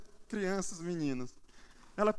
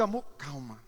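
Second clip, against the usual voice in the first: low, softer unruffled reply; calm husband; 6 semitones lower, 3 dB quieter than usual. Retold or imitated. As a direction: settle a panicking wife with a dropped voice. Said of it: "eu olho a situação". (marido tranquilo, voz baixa)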